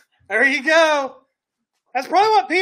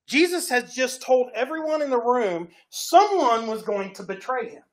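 ordinary talk

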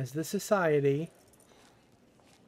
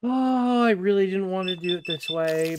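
exasperated; 4 semitones higher, 6 dB louder than usual. Real, not acted. Forced into a fight.

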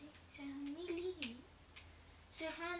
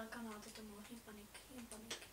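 quiet; 6 semitones lower, 12 dB quieter, faster than usual. Real, not acted. Calming himself quietly.

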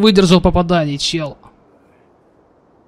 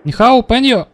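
higher, louder sing-song mock Chinese "Нихао, паньё"; mock Chinese accent; 9 semitones higher, 4 dB louder than usual. Streamer greets enemy players, hammed-up mock Chinese accent. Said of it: "Царюха, ну-ка зацени".

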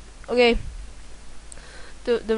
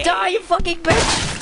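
higher, yelled mock threat; mock fury shout; 7 semitones higher than usual. Gamer smashing a game character, mock furious shout. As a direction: bellow it like a cartoon villain.

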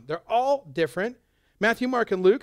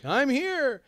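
bright, higher-pitched boast; a proud little I'm here; self-important; 6 semitones higher than usual. Retold or imitated. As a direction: announce yourself to the room.

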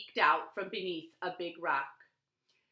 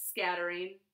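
ordinary delivery, nothing marked

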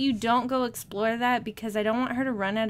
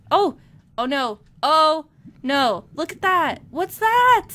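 loud alarm rising in pitch; 4 semitones higher, 10 dB louder, slower than usual. Real, not acted. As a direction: build to a high, loud startled question. Spots a huge shark.

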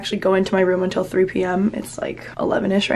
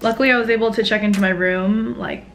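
normal talking voice

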